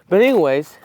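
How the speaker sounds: usual speaking voice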